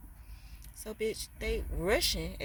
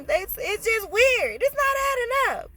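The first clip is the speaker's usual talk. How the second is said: disbelieving squeal; 7 dB louder, slower than usual. Real, not acted. Disbelief while venting.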